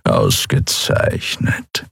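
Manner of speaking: breathy, whispered purr, very low and very slow. Acted. Old cat lord to his servant, a slow purr. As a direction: purr the word like a contented cat. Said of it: "schnurrte Chasu".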